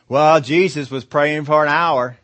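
loud, drawn-out mock protest; mock objection. Preacher voicing an objector to short prayers, mock protest. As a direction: protest it slowly and loudly.

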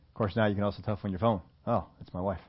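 quiet aside. Real, not acted. Quiet aside about phone.

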